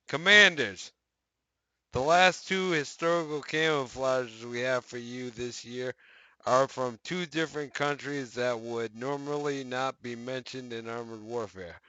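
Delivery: brighter announcer reading voice; news article read. Acted. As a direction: read it like a patch news post. Reads out the game news article.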